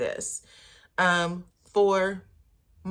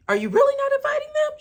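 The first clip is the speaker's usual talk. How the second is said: much higher, louder and fast, squeaky disbelief; high squealing plea; 7 dB louder, faster than usual. Retold or imitated. Kimmy begging about the party invite in a high, loud, fast squeal. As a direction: squeal it like a stunned friend.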